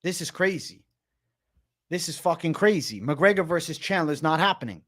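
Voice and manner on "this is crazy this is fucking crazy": ordinary talk, nothing marked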